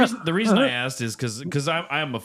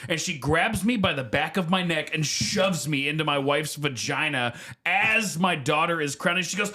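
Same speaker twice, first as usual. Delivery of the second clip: normal talking voice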